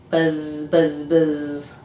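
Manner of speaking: low buzzing bee voice. Shown as a demonstration. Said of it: "I'll give you a hint".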